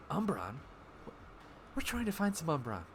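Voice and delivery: lightly voiced dreamy, not-with-it girl; a hint of someone drifting off; spacey Alara. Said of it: "it was more like this, just kinda not with it".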